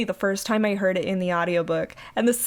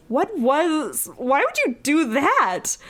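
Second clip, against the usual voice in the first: high-pitched, incredulous demand; the heroine aghast, reading it dramatically; shocked; 6 semitones higher, slower than usual. Acted.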